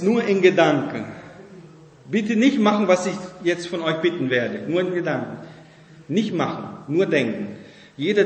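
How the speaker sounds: normal talking voice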